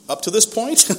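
high and loud, rising on this; emphatic. Real, not acted. Testimony speaker stressing he had never been arrested. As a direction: push up to this point up high.

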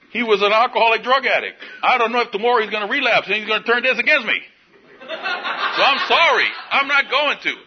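half laughing, sheepish apology; talking through real laughter. Real, not acted. Laughing over a confession.

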